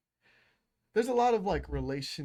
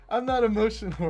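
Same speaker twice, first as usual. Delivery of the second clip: high, loud mock denial; exaggerated heated protest; mock outburst; 8 semitones higher, 9 dB louder than usual. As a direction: burst out protesting, hammed-up. Repeating the mocked denial.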